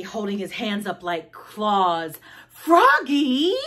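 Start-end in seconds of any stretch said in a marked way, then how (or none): 2.5-3.6 high, loud call with rising end. Acted. Librarian calls roaring Froggy, loud high call. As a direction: call the name in sharp warning. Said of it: "called Miss Otterbottom".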